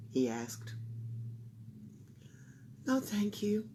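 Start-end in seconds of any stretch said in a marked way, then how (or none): 2.9-3.7 soft sad reply; sighing. Acted. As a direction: sigh it out. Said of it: "sighed Camilla".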